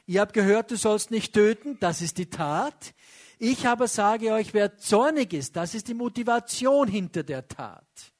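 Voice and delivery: loud, pointed sermon contrast; emphatic preaching. Acted. Preacher contrasting law and heart, emphatic. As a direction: press each word on the listeners.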